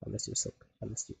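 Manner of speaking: fake-teary whisper to a lost pickaxe; mock tearful whisper. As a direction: break down in a pretend sob-whisper. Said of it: "I'm gonna cry".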